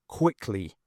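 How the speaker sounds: ordinary delivery, nothing marked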